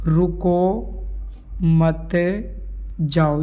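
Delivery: long pauses between रुको, मत and जाओ; word by word. Shown as a demonstration. Teacher writing an example for students, slow word-by-word dictation. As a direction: draw out each word as if writing it. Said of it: "मैंने आपको एक वाक्य लिखा".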